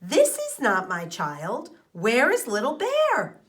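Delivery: demand, then cry out for the cub; worried bear calling for her child; alarmed mother bear. A narrator's reading. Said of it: "she cried".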